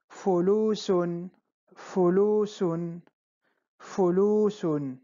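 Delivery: fulusun spoken slowly as one blended word; slow model reading. Shown as a demonstration. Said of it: "fu, Lu, la última, fu, lu, sun".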